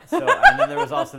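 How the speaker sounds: cracking up mid-story; laughing. Real, not acted. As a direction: giggle through the setup. Laughs while telling story.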